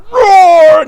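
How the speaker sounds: very loud, drawn-out roar; bear roar. Acted. Mister Bear scares off the red bees, roaring. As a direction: roar the word out long and loud. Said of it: "Mister Bear roared".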